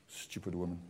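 very low, soft muttered grumble; grumbling self-talk. Acted. Husband stewing about his wife, muttering to himself. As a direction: mutter the complaint under your breath. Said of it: "my self-talk goes on all day".

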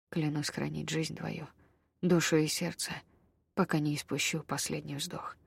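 soft, hushed vow; a quiet vow full of tenderness; doomed tenderness. A narrator's reading. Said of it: "звучала щемящая ... обречённая нежность".

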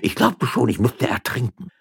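put-on shaken old man after near drowning; stammering old man. A narrator's reading. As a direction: splutter it out, still half-drowned. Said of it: "stammelte der schändliche Alte".

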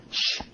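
soft unvoiced shush; hushing shh. Manager shushing the maid away from the phone, soft shush. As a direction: hush her quietly aside.

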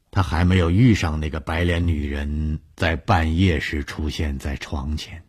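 ordinary delivery

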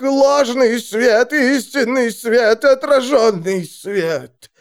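high, slow, eerie seer voice; prophesying seer. A narrator's reading. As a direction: cry visions out in trance.

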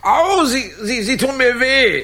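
high, loud yelp of pain; pain cry. Acted. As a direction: cry out as he is hurt. Man cries out in pain. (hoher, lauter Schmerzensschrei)

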